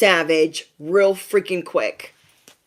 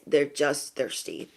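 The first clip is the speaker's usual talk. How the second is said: low, slow, much softer; quiet emphasis; 4 semitones lower, 9 dB quieter than usual. Real, not acted. Reassuring besties about the gossips, quiet slow repeat. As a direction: let it sink down slowly and quietly.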